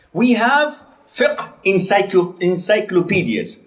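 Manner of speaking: ordinary delivery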